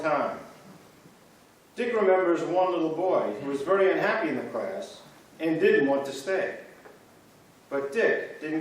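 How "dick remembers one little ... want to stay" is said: usual speaking voice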